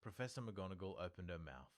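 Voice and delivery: plain reading voice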